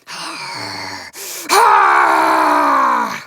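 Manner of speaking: deep distorted creature roar; monster roar. Shown as a demonstration. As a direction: roar like a huge beast. Creature sound demo, processed.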